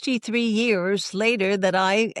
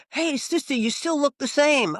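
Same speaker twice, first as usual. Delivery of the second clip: higher, eager greeting from a former student; voicing a young man; 7 semitones higher than usual. Former student greets the sister on the street, higher and eager. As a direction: pitch it up as the cheerful student.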